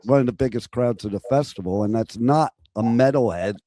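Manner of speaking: normal talking voice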